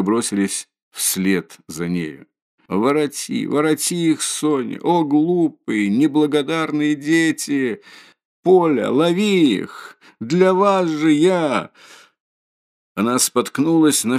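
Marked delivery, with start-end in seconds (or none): 2.7-11.7 high, slow, tearful calling; desperate cry. A narrator's reading. Mother begs Sonya to catch the children, desperate. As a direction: beg them to turn back, near sobbing.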